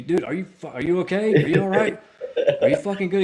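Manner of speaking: high, loud frantic check-in; panicked yell. Retold or imitated. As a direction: yell it, scared he's been hit. Frantic check on driver after hit.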